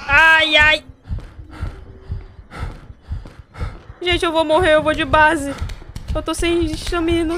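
being chased. Real, not acted. Fleeing, out of stamina.

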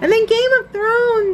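high squeaky pet voice; chatting for the dogs, way up high; voicing the dogs. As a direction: keep the puppy voice going. Continuing the dog voices.

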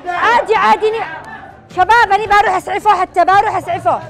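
panicked shouting. Acted. Staged injury chaos, shouting.

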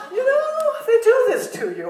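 high laughing exclamation; breaking into laughter about the irony. Real, not acted. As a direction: laugh it out in disbelief.

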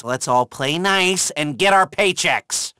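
normal talking voice

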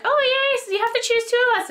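high, squealing fangirl voice; giddy girls swooning over gondoliers. Retold or imitated. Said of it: "We're like".

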